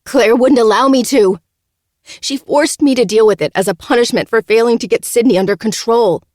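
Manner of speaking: high, loud desperate plea; crying out. Acted. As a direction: cry out, pleading with him. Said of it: "Francesca cries, nearly pleading".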